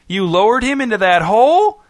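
high incredulous question, squeaking upward; rising squeak. A narrator's reading. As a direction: let disbelief push the pitch right up. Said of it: "his voice rising to a squeak".

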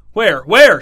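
loud alarmed shout. A narrator's reading. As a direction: yell it, startled and hopping. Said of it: "shouted Trace".

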